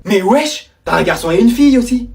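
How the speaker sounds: ordinary delivery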